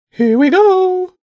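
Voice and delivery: very high, loud, stretched pigeon call; bishop bird. Acted. Bishop bird launches the flight, high bird call.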